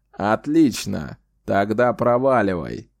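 put-on slow, menacing old wizard; sinister threat. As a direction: lean in and threaten, slowly. Old wizard dismissing the beast.

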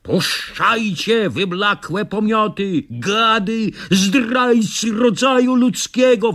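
slow, high indignant cries; shouting. Acted. Captured narrator curses his captors, slow high indignant cry. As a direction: drag out the insults while struggling. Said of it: "wołał ja".

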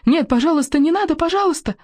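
high-pitched desperate plea; terrified cry. Acted. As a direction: beg for mercy in a high cry. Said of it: "Раздался новый крик". (испуганная высокая мольба)

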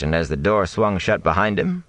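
plain reading voice

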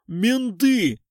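high loud Менты! with a long falling end; shocked cry. A narrator's reading. Tramp alerting his pals, loud falling cry of Менты!. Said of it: "Лохматый ошарашенно обернулся".

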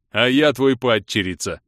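громче, изменённым дурашливым голосом; выдай шутку на бегу; рыцарь-шутник. A narrator's reading. Рыцарь встревает с собственной шуткой. (шутливый голос рыцаря)

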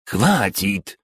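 sharp outburst, losing his temper; exclaiming. A narrator's reading. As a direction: snap out the word as patience breaks. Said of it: "воскликнул Шакти, потеряв самообладание".

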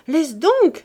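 put-on laughing little girl pushing him off; playful girlish laugh. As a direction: tease him like a child at play. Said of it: "avec un rire de gamine qui joue".

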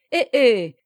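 loud mocking "heh heh"; acted derisive snigger; sneering chuckle. A narrator's reading. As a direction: snicker it out scornfully. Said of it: "dit-il en ricanant".

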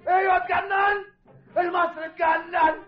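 high, raging declaration of madness; enraged farmer shouting; furious outburst. Acted. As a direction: scream that he has lost his mind. Enraged man confronting Hamed's men.

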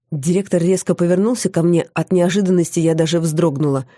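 usual speaking voice

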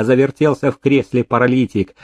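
plain reading voice

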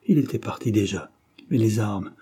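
ordinary delivery

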